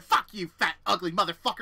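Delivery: loud, snarling insult; retold insult yell. Roommate cursing him out during the fight, loud snarl. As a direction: throw it back at him.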